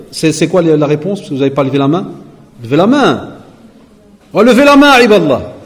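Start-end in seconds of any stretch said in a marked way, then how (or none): 4.3-5.5 lancé fort et aigu au public; appel fort. Real, not acted. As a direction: Levez la main, interpellé d'une voix haute et forte. Calls on the audience to answer. (l'orateur interpelle le public qui ne lève pas la main, d'une voix forte et aiguë)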